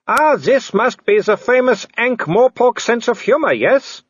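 high, loud taunting villain; Wolfgang. Acted. Werewolf taunting the man up the tree, high mocking call. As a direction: call out mockingly to cornered prey.

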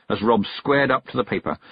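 ordinary delivery, nothing marked

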